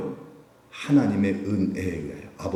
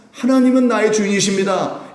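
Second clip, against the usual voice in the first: high, loud declaration of faith; fervent confession; 11 semitones higher, 6 dB louder than usual. Shown as a demonstration. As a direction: proclaim it with full voice. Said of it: "고백하면서 하시길".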